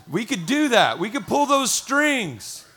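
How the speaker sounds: loud, emphatic pulpit delivery; impassioned preaching. Real, not acted. Pastor telling congregation why they serve, impassioned. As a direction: preach it with full force, punching key words.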